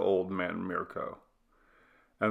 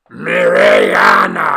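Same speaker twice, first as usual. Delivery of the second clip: much lower, very loud, slow holler; loud shout; 12 semitones lower, 16 dB louder, slower than usual. A narrator's reading. Throatiest man hollering her name as loud as he can. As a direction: holler from deep in the chest. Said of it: "shouted Chebo, as loud as he could".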